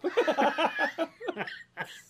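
high burst of laughter; real laugh. Real, not acted. Host cracking up at his own story, real laughter. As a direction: crack up long and helplessly.

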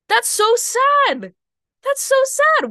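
high, slow, loud wailing over her own story; mock sorrow. Telling viewers her own past, wailing in mock sorrow. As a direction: cry out in hammed-up pity.

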